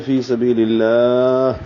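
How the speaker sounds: slow فی سبیل اللہ, long final aa closing on a breathed h; lengthened stop. Shown as a demonstration. Teacher showing a pause, فی سبیل اللہ with lengthened ending. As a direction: stretch the last vowel of اللہ, then stop. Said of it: "لمبا کریں گے، وقف کرتے ہوئے".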